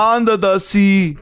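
slow, high mock-Jamaican crab; hammed-up Jamaican cartoon voice; Sebastian. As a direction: stretch it out in a put-on Jamaican accent. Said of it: "the Sebastian from The Little Mermaid".

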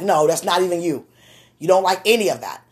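ordinary delivery

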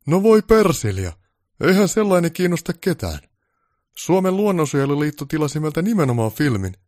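theatrical boss tirade; exasperated director. Acted. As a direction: huff at such nonsense. Director rants for drama.

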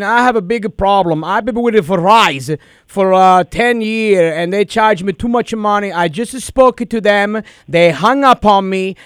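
prank caller in a thick put-on Italian accent. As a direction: play an Italian immigrant dad, hammed-up. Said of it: "but I got a lot of accent".

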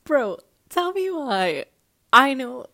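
high, loud, slow exclamation; excited. Real, not acted. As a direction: call it out, worked up. Excited outburst after laughing.

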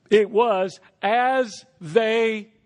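ordinary talk

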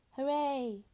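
hollow hooray, little joy; a cheer with nothing behind it; empty cheer. Acted. Father answers news of triplets, emptily. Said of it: "said Welling emptily".

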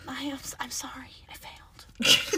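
soft, whispered apology; sheepish apology. Real, not acted. Friend owns up to failing, sheepish whisper.

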